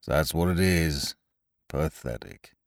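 mope it out, dragging each syllable; gloomy donkey. A narrator's reading. Old grey donkey sighs at his reflection, low and gloomy. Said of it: "said Eeyore gloomily".

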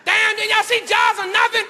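high-pitched mock holler at the screen; heckling moviegoer. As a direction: yell it up high in exasperated disbelief. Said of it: "the brother was like".

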